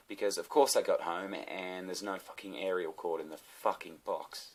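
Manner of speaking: usual speaking voice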